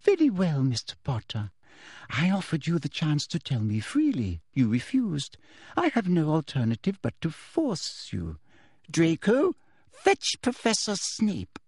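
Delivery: sugar-coat the threat; falsely sweet Umbridge. A narrator's reading. Umbridge ordering Draco, sickly sweet and menacing. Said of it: "in her most dangerous and falsely sweet voice".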